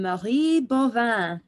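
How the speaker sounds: slow, careful French accent on the name; a French accent on Marie Bovin, slowed right down. Shown as a demonstration. OB doctor tries the inventor's name with a French accent. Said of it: "My French accent is".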